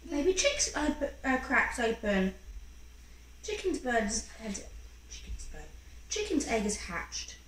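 ordinary delivery

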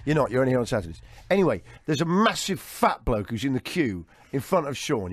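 usual speaking voice

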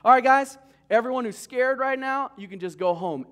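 loud call to the crowd; mock commander call. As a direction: call out to the troops. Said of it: "and he said".

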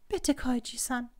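almost whining entreaty; pleading. A narrator's reading. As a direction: beg him, close to a whimper. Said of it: "mit flehentlichem, fast winselndem Ton".

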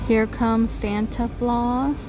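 ordinary talk, nothing marked

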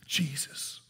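whispered name, almost no voice; a hushed, awed whisper to the congregation; reverent whisper. Real, not acted. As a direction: breathe the name like a prayer. Reverent whisper after naming Jesus.

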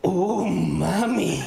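drawn-out, very slow lustful sigh of words; slow swooning "oh mommy". Pretend. Comic swooning at the offal-loving date, very slow. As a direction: savour each word, slowly.

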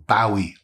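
"Bowie" as BOW-ee, rhyming with "wowie"; alternative pronunciation. Shown as a demonstration. Knife maker listing ways to say it, "Bowie" as BOW-ee. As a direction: say "Bowie" with an OW as in "cow". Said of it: "I've heard it pronounced so many different ways".

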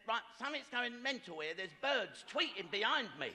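agitated wife. Retold or imitated. Wife complaining over her ironing about the birds, mock agitated. Wife alarmed by speakers, acted quarrel.

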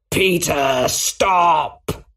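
high, urgent cry of stop; a sudden desperate plea, pitched high. A narrator's reading. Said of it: "the Green Goblin cried".

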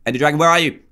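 ordinary delivery

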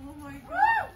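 ordinary talk, nothing marked

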